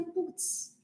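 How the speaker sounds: a soft, breathy putz of dismay; whispered putz. Retold or imitated. Recalling her reaction to the dentist's advice, a whispered putz. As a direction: mouth putz almost without voice.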